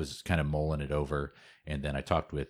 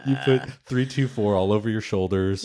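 louder, higher, excited repeat; 5 semitones higher, 7 dB louder than usual. Acted. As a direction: announce it with a grin. Repeating the product name, amused.

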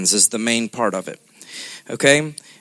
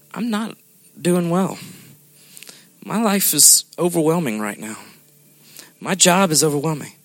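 soft, halting confession of struggle; subdued, understated vulnerability; honest admission of pain; 5 dB quieter than usual. Acted. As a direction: quietly admit you are hurting, with pauses. Models honest answer when hurting.

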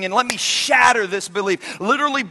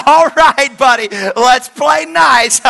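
mock polite dad; 4 semitones higher, 10 dB louder, slower than usual. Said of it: "and I'm like".

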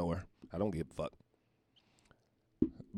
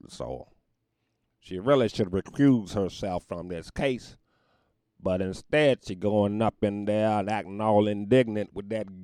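loud, emphatic courtroom oratory; formal pronouncement; 3 semitones higher, 9 dB louder than usual. Acted. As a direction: lay down the court's rules, loud and firm. Holds forth like a judge.

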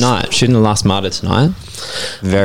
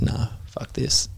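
tired whisper; 3 semitones higher, 12 dB quieter, slower than usual. Pretend. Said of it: "I'd be like".

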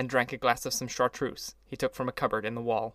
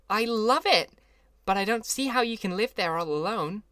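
higher, louder delighted Daisy; gushing Daisy; 9 semitones higher, 4 dB louder than usual. A narrator's reading. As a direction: gush over the grand house. Daisy gushing over the house.

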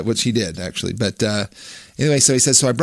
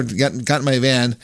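ordinary talk

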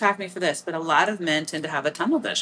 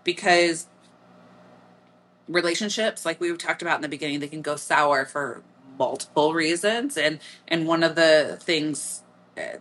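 ordinary talk

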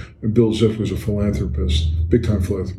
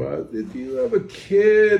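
much higher, slow, timid asking; sheepish question; 10 semitones higher, slower than usual. Retold or imitated. Strangers sheepishly asking Al about his kid, high timid voice. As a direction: ask it hesitantly, embarrassed to pry. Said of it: "look at you sheepishly and go".

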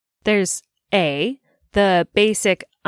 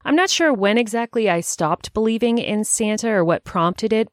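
normal talking voice

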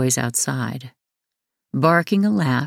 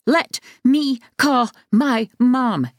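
1 demanding shout; 10 semitones higher, slower than usual. A narrator's reading. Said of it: "Devon punched out".

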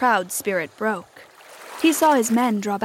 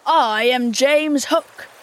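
slow, loud, grand pirate captain; Hook; 3 semitones higher, 6 dB louder, much slower than usual. A narrator's reading. Peter poses as the pirate captain, loud and slow. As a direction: declare the name slowly and loudly. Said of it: "answered in Hook's voice".

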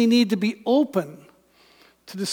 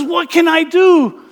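higher and louder, pleading; desperate cry; 7 semitones higher, 6 dB louder than usual. Acted. Moses crying out to God, a higher desperate plea. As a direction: cry out for help in panic. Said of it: "Moses cries out".

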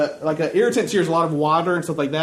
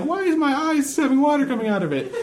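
put-on little girl voice; 8 semitones higher than usual. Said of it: "the little girl's like".

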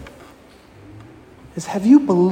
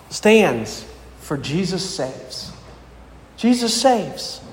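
1 slow emphatic; slower than usual. Real, not acted.

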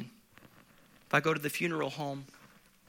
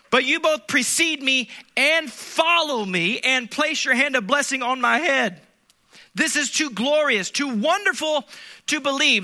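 louder, slow, building preacher's cry; preaching at full force; 9 semitones higher, 7 dB louder than usual. Acted. Preacher urging his congregation, building to a loud slow cry. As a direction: press the point home to the congregation. Reading psalm with fervour.

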